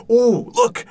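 high, loud sudden wonder; excited gasp. Acted. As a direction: burst out in delight at the tank. Discovering aliens in the tank.

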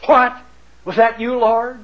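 high, halting startled question. Acted. Paul answering the voice from heaven, startled. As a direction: ask it timidly upward, taken aback.

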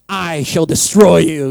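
mock threat. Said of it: "It's like".

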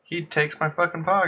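ordinary delivery

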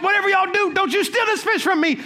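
high-pitched playful warning, retold. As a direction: warn the room with hyped-up energy. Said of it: "We have all this energy".

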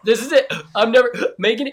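high, fast panicked last words; dramatic dying kid. Retold or imitated. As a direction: ham up a dying child. Kid thinks they're dying.

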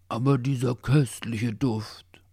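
slow, breathy, lifted swooning; dreamy purr. A narrator's reading. Hungry dog swooning over the pastry smell, dreamy murmur. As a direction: sigh it out slowly, half whispered and lost in reverie. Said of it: "säuselte Leo verträumt".

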